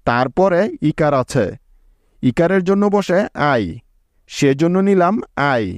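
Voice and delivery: ordinary talk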